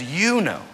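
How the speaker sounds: "You" pitched high in "You know"; stress on You. Acted. Preacher shows where Peter's certainty lies, stress on "You". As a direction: lean on "You", pitched well up. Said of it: "it doesn't matter what we know".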